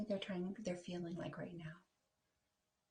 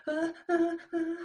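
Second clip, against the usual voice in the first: high, loud whimpering; frightened whimper; 11 semitones higher, 11 dB louder than usual. Acted. Sam whimpering at the wagging puppies. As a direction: stammer in fright.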